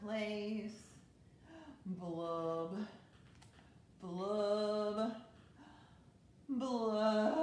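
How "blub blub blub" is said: blub like a sulky fish, getting louder each time; bubbly fish sounds growing louder; fish blubs building louder. Acted. Fish bubble sounds after refrain.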